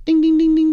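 high ringing ding ding; bell imitation. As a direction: ring it out like a little bell. Imitating bells on wife's jeans.

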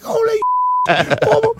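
very high, hammed-up panicked squeal; mock panic. Pest guy discovering six-foot iguana, mock panicked cry. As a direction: wail helplessly in comic alarm.

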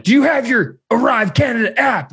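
high, emphatic mock interrogation; demanding border guard. Guard checks travelers' papers, high-pitched demand. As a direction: demand it word by word.

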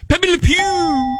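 high, slow mock French; French accent. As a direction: stretch the name out in a silly French accent. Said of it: "is French".

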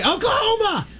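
high-pitched pretend holler across a party; mock yell. Shouting a code word across the party, mock yell. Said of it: "yelled across a party".

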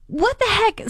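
high, startled "what the heck"; a high yelp of confusion; shocked disbelief. Retold or imitated. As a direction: caught completely off guard. Kids just hit her car.

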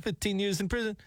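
high, imitated non-American accent, scammer on the phone. Host imitating the scam caller's non-American accent on air. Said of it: "he doesn't have an Americanized accent".

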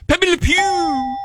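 high, stretched-out mock French; French accent. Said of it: "is French".

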